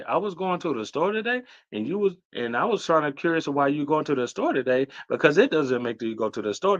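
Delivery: mock Canadian accent, "today" rising at the end like a question that never comes; Canadian uptalk. Teasing Toronto viewers by imitating Canadian speech with a questioning rise. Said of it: "they talk like".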